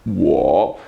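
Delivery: "Wo" low and dipping, as one syllable; blended syllable. Shown as a demonstration. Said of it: "the word I".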